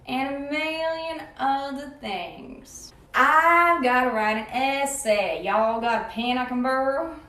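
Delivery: slow, stretched Southern drawl; Southern accent. Shown as a demonstration. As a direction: put on an unhurried Southern US accent. Unannounced Southern accent in accent demo.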